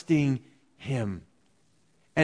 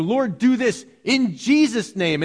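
mock incantation; 5 semitones higher, 4 dB louder than usual. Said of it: "as an incantation".

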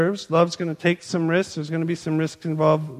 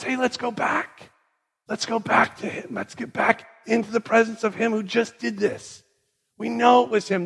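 eager urging; 6 semitones higher than usual. Acted. Healed man urges others to return.

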